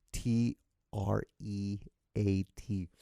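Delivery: hushed slow spelling of T R E A T; spelled out. Real, not acted. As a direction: spell it slowly so the dog won't catch on. Spelling word so dog won't know.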